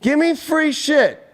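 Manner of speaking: mocking whine. Mocking the client who wants free readings: slow, high mock whine. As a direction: drag it out like a spoiled beggar. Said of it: "But out of nowhere".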